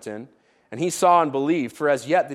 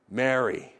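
tender name; 5 semitones lower, 5 dB quieter than usual. Acted. Jesus speaks Mary's name.

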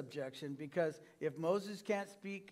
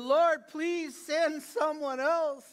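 pleading; 8 semitones higher, 6 dB louder, slower than usual. Retold or imitated. Moses pleading with God.

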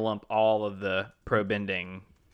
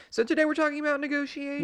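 upbeat announcer; faster than usual. Acted. Host segment announcement.

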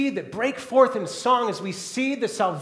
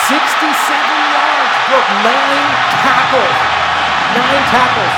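louder, higher, thrilled; excited hype; 8 semitones higher, 14 dB louder than usual. Real, not acted. Preacher hyping the Marshawn Lynch run to the congregation, excited and loud. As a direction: rave about the run. Preacher hyped over football clip.

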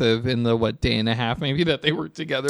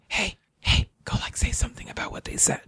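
quick, hushed whispered prompt; sneaky stage whisper; conspiratorial whisper; 6 semitones higher, 5 dB quieter than usual. Retold or imitated. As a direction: whisper it like a producer egging someone on from off camera. Imitating production secretly prompting the contestants.

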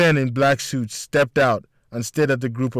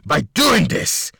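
low gravelly villain voice; gravelly menacing voice; 4 semitones lower than usual. A narrator's reading. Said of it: "His gravelly, menacing voice".

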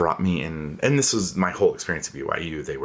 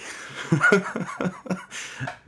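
a bright, high burst of laughter. Real, not acted. Recalling the festival's debauchery, a high laugh. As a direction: laugh at the memory.

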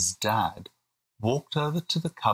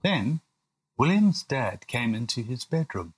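ordinary delivery

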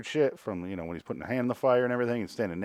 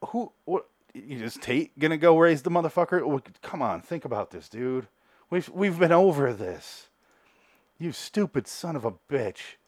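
higher, put-on scolding woman's voice; mock exasperated Viv; 5 semitones higher than usual. Viv scolds Ben for giving up the baby, higher mock exasperation. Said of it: "she's like".

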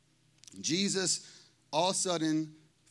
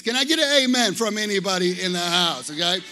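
louder, rallying the room; call for amen; 8 dB louder than usual. Real, not acted. Pastor calling out to the congregation for an amen, rallying. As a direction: rouse the crowd for a response.

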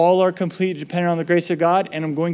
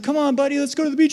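coaxing parent; 9 semitones higher, much faster than usual. Retold or imitated.

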